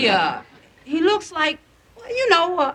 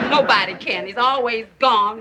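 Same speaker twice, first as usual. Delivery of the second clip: ordinary talk, nothing marked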